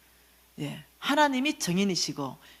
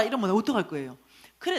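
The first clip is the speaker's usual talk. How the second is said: ordinary delivery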